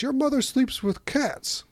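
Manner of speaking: high-pitched mock yell; yelling insult. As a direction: hurl it like a kid's taunt. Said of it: "And Fool yells".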